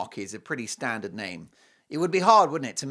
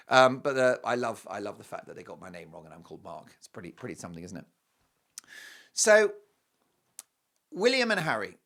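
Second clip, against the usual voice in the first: normal talking voice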